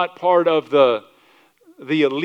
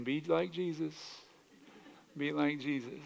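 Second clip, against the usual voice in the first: very soft, restrained self-talk; thinking to oneself; 14 dB quieter than usual. Acted. Driver reminding himself while being yelled at, hushed thought. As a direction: murmur it inwardly as a reminder to stay calm. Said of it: "You're thinking".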